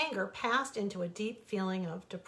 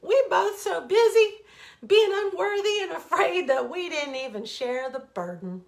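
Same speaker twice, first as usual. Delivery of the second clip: laughing; 8 semitones higher, 10 dB louder than usual. Acted. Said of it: "gasped out".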